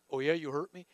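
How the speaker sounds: low, quiet tough-guy retort; understated vengeful comeback; mock movie threat. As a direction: play a softly menacing action hero. Mimics action-movie revenge talk.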